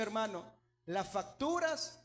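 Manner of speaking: ordinary delivery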